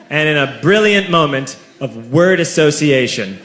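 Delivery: ordinary talk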